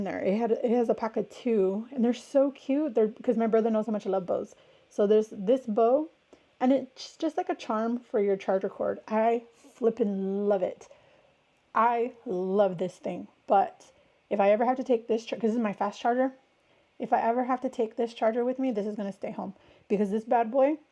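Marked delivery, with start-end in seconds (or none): none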